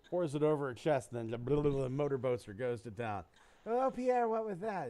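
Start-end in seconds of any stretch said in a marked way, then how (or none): 3.6-4.9 high, hammed-up gasp of a startled lady; mock swooning woman. Joke teller plays the woman gasping at Pierre, high mock swoon. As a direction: squeal it like the shocked lover.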